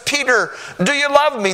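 high, loud plea; Jesus asking Peter, high and loud; urgent question. Acted. Third time Jesus asks.

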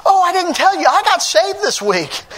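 high-pitched, overjoyed retold announcement; elated voice of a new convert; joyful. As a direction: burst with glad news, slowing at the end. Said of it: "there's a joy to you".

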